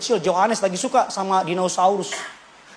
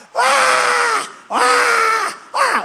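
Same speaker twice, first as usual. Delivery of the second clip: much higher, piercing mock child wail; toddler screaming; much slower than usual. Preacher imitates son wailing in the mall, high mock scream. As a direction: wail like a toddler denied his popcorn. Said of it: "dia teriak".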